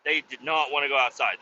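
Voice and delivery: normal talking voice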